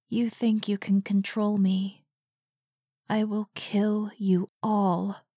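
whispery, drawn-out disembodied threat; menacing entity. A narrator's reading. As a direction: threaten slowly, sinking into a whisper. Voice from everywhere threatens them.

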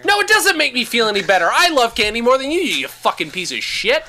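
loud playful rant; mock rant at his friend; mock anger. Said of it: "I'm railing on him".

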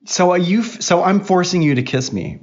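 high-pitched, put-upon protest; indignant disbelief. Real, not acted. Dad asking if he forces kisses, indignant disbelief. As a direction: push it up high as if wrongly accused.

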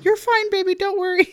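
put-on high, sweet coo to a child; reassuring mother. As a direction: soothe a little one in baby-talk pitch. Switches to reassuring her son.

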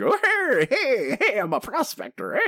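high-pitched, hammed-up prospector cries; mock prospector whooping that settles on the last words; prospector impression. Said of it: "He talks like".

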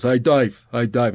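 imitating an Aussie twang on Dave; playful put-on Australian accent; mock Australian accent. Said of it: "he made fun of my accent".

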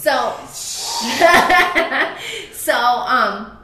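loud real laugh breaking the talk; can't stop laughing; real laughter. Real, not acted. Mother laughing while telling son's story, laughing hard.

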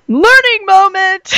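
high, loud, stretched-out cheer; mock-triumphant excitement. Parent and daughter celebrate the discovery, hammed-up excitement. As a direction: sing it out slowly like a celebration.

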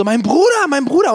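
imitated high, loud yell; übertriebenes Schreien. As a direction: mimic a girl's over-the-top shouting. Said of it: "sie schreit auch so übertrieben laut". (übertrieben lautes, hohes Nachäffen)

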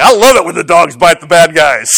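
a hint of a gloating dispatcher; tough wife. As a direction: relish the bad guys getting bit. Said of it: "she's like".

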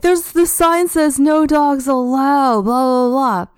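high mocking imitation of her yelling; woman shouting from the fence, mocked high; mock screaming.

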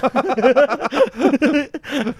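high, loud burst of laughter. Real, not acted. Friend laughing at the message sent. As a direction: crack up.

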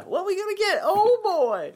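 high cartoonish put-on voice; silly high voice. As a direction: play along in a squeaky voice.

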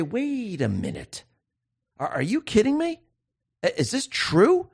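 like people suddenly realizing they were fooled; stunned disbelief. Retold or imitated. People waking up to the news, voiced in high disbelief. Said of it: "They're going to say".

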